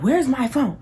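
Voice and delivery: high-pitched, exasperated demand; retold irritation. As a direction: mimic your mad self hunting for the phone. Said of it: "I'm kirking off. I'm mad".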